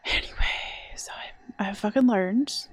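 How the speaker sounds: half-whispered rueful admission; whispered aside. Real, not acted. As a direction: mutter it under your breath, sheepish.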